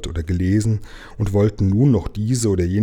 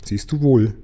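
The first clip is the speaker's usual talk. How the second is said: higher, pert little jab; a bit snippy; 4 semitones higher than usual. A narrator's reading. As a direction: say it as a saucy told-you-so. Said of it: "ein wenig schnippig zu ihm sagte".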